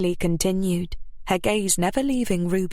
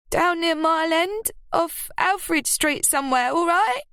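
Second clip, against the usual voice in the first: high, impatient retort; irritated Ruby; 11 semitones higher than usual. A narrator's reading. Ruby snapping the location at Lily. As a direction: get her off your back.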